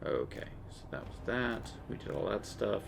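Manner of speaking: usual speaking voice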